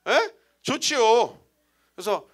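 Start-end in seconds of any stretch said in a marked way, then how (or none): 0.6-1.4 higher, louder eager "좋지요" out of anger; spiteful yes. Retold or imitated. Pastor accepting a deacon's invite to spite his wife, loud. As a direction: agree loudly just to spite her. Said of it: "왜냐면 화가 났으니까".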